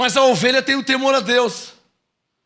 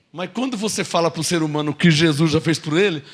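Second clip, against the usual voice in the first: ordinary talk, nothing marked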